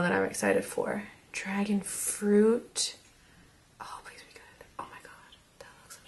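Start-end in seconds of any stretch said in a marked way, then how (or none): 3.8-6.0 breathless hopeful whisper. Real, not acted. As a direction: whisper it, willing it to work. Anxious hope then delight.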